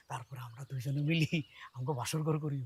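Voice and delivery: hushed, suggestive murmur; coy whisper. Acted. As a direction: drop to a low, intimate whisper. Coyly suggests a wedding night. (লাজুক ফিসফিসে গলায়)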